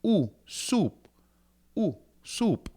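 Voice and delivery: O U as oo in soup; diphthong demo. Shown as a demonstration. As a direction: stretch out the oo like in soup. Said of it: "O U".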